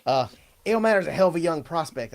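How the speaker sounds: ordinary delivery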